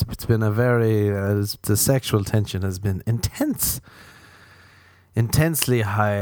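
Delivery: usual speaking voice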